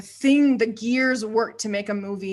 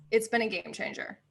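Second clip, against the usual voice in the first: ordinary talk, nothing marked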